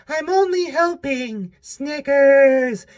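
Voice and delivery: a creepy masked lady insisting she is helping; fake lady voice. YouTuber voices the fake lady grabbing the shopping cart, high and slow. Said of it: "the voice is so funny".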